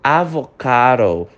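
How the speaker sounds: "Avocado" with the D sounded like a tapped R, slowly; pronunciation model. Shown as a demonstration. Teacher showing how to pronounce avocado. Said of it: "Repara na pronúncia dessa palavra".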